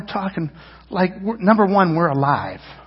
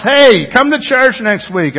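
slow, louder call at the door; mock-cheerful doorstep invite, slowed; hailing a neighbour at the door to come to church; 5 semitones higher, 6 dB louder, slower than usual. Youth inviting people at doorsteps.

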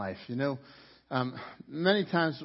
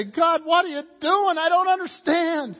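high, loud anguished plea; crying out to God; 13 dB louder than usual. Retold or imitated. Pastor voicing his own cry to God, anguished. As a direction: cry out in desperate prayer. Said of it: "I cry out to God and I say".

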